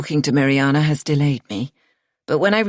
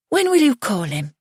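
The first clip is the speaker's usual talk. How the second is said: mother's voice; 8 semitones higher than usual. A narrator's reading. Mother's voice in story.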